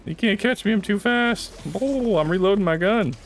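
high playful taunt. Real, not acted. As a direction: tease them you're too fast. Taunting enemy in game.

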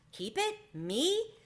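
can't believe his luck; startled mouse asking if he heard right; astonished. A narrator's reading. Mouse offered the motorcycle.

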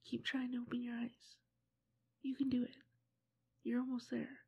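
gentle close-mic whisper, coaxing. Acted.

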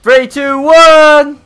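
building to a yelled one; countdown shout. Real, not acted. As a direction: count it down, rising to a roar. Countdown before a stunt jump.

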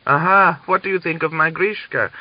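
loud, high boastful crowing; bragging. Acted. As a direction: crow about his son proudly. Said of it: "he bragged all through the village".

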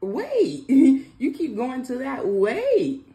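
loud, high, laughing "wait"; amused protest. Real, not acted. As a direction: call a halt through a laugh. Laughingly stops his repeated choice.

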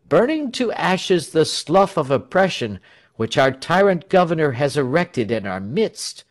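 high-pitched, declamatory speech; grand oratory. A narrator's reading. Tell proclaiming revolution to crowd, grand oratory.

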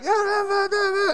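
very high, strained yeahs; tense high yeahs. Retold or imitated. Man clenching before a gunshot, high and strained. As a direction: squeeze them out bracing for the shot. Bracing for the shot.

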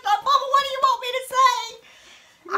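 high, loud pleading whine; mock child wail. Child to mama during a whipping, high exasperated wail. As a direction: plead loudly in a high little voice.

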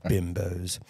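usual speaking voice

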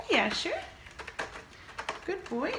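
usual speaking voice

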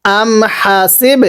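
হাসিব slow, its final ব released with an echoing bounce; qalqalah. Shown as a demonstration. Teacher shows the sakin ba rule, qalqalah. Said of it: "কলকলা করে পড়তে হয়".

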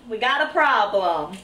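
a light mock American accent on "We got a problem"; hint of a movie-tough American accent; playful American accent. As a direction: toss it off in a jokey American accent. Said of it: "trying to do American accents".

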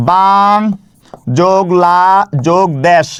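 বাং, লা, দেশ each drawn out with pauses between; broken into syllables. Shown as a demonstration. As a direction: sound out বাং যোগ লা যোগ দেশ piece by piece. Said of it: "এটাকে ভেঙে ভেঙে উচ্চারণ করে". (বাং যোগ লা যোগ দেশ ভেঙে ভেঙে)

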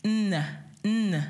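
"n, n" sung as a buzzing nasal n; teacher demoing the n sound. Shown as a demonstration. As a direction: push the "n, n" through the nose. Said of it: "like you're doing a n sound".

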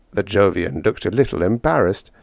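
usual speaking voice

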